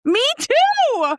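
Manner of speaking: high, loud yell; comic outburst. Real, not acted. Co-host interrupting the book recap, high comic yell. Yelled interjection over the story.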